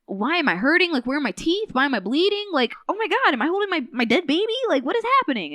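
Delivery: high, loud, acted-out panicked questions; mock panic, pitch climbing as the questions pile up; freaking out, confused. Helen waking bleeding with no teeth, confused and freaking out. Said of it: "she's freaking out".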